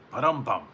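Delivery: mouthing a joke drum fill, ba-dum-bum; rimshot sound. Pretend. After his own Uber joke, a playful vocal rimshot. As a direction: sell the punchline with a vocal rimshot.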